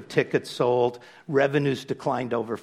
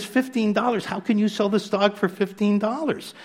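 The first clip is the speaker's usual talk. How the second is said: much higher, shocked "Fifteen dollars?"; stunned buyer questioning the price; incredulous disbelief; 9 semitones higher than usual. Retold or imitated. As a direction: echo "Fifteen dollars?" as if it's absurd.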